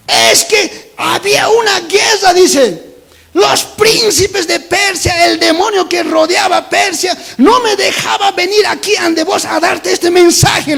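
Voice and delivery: high, loud, slow preacher's cry; weary angel, shouted. Acted. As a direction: shout the embattled angel's report, drawn out. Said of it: "Cansado llega". (ángel agotado, a gritos lentos)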